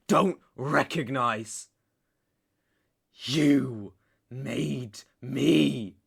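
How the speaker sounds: loud, emphatic rant slowing to spaced words; furious accusation. Acted. Betrayed man blaming his former lover, furious accusation.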